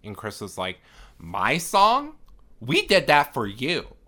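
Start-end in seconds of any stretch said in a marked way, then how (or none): 1.2-3.9 high, loud mock outrage; acted-out indignant comeback in an argument; indignant retort. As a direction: fire back offended, pitched way up. Acted quarrel, character protests indignantly.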